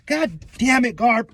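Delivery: high-pitched mock frustration; exasperated cursing. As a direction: curse him in comic exasperation. Acts characters cursing Garp.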